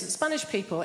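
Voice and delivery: usual speaking voice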